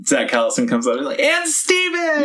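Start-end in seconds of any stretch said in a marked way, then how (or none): 1.2-2.2 drawn-out, high-pitched "And Steven!" shout; mock yell. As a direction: belt out "And Steven!" like an announcer. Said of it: "he yells it".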